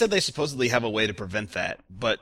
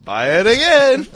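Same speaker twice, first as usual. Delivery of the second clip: mocking; 13 semitones higher, 12 dB louder, slower than usual. Mocking Sony's rebuy policy.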